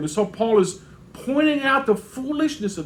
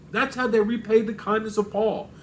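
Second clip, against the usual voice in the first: usual speaking voice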